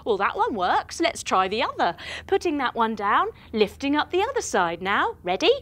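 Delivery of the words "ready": usual speaking voice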